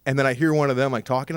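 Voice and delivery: ordinary talk, nothing marked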